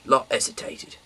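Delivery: usual speaking voice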